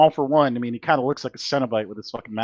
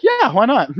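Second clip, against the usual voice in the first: carefree agreement; 11 semitones higher, 6 dB louder than usual. Retold or imitated. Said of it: "me and Wyatt were like".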